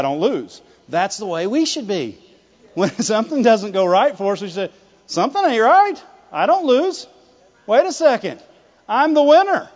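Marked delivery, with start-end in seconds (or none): 5.1-9.7 high-pitched, insistent protest; raised-pitch, emphatic objection; mock indignant winner. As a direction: act out a winner who refuses to accept it. Acts out a winner refusing to lose.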